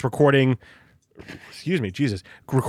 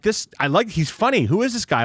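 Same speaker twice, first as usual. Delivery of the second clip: put-on young kid; 6 semitones higher than usual. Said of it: "eight, nine years old".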